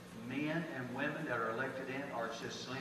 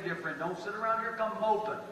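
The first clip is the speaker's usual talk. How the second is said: ordinary talk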